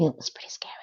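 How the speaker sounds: quick confiding whisper; whispered aside. Real, not acted. Vlogger confiding to viewers about Miranda's faking, whispered. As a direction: lean in and whisper it fast like gossip.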